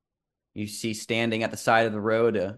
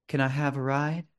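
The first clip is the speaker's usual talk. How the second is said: put-on soft, quiet sad young woman; sad ghostly woman; 4 semitones higher, 4 dB quieter, faster than usual. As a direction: ask it quietly with a sad little smile. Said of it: "slight smile and says".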